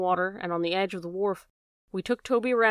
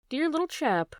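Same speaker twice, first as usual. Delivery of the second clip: strategic charm; 7 semitones higher than usual. A narrator's reading. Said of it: "said Holmes strategically".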